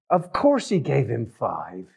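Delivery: normal talking voice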